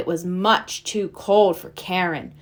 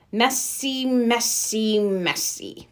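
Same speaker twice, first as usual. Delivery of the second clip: grumbling professor; 4 dB quieter, much slower than usual. Acted. Magician grumbles at his failed tricks, lightly voiced. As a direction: mutter it as tricks keep failing. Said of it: "the professor grumbled".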